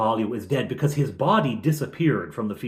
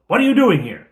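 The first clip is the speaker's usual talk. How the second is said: loud, high shout across the camp; shouted challenge; 11 semitones higher, 10 dB louder, faster than usual. Retold or imitated. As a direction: shout it out to the goblins. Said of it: "a sort of a shouted parley".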